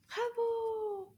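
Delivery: high, long-held cheer; sing-song high bravo; delighted praise. Real, not acted. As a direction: cheer the learner with a stretched final vowel. Cheering the learner on.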